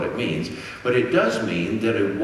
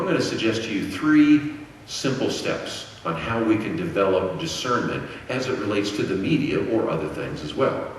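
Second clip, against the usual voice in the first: ordinary talk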